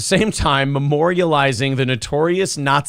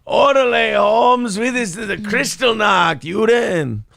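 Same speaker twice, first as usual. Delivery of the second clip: put-on hardcore Mexican accent; 5 semitones higher, slower than usual. Host imitating the caller's hardcore Mexican accent. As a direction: shout it like a cartoon Mexican tough guy. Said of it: "hardcore Mexican accent".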